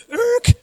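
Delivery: sudden high screech noise; abrupt screech. Retold or imitated. Sudden change in the story, screeched "irk". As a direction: sound of a record jerking to a halt.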